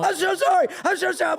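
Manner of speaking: high, loud, repeated mock apology; retold gushing apology. Customer apologizing to the waiter he insulted, effusively. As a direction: pour out an exaggerated apology.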